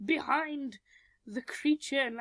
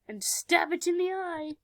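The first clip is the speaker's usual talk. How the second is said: ordinary delivery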